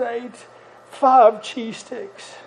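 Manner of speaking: put-on a seven-year-old confessing to his dad in a small voice; tearful confession. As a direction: let the guilty child own up quietly and slowly. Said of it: "teary eyes".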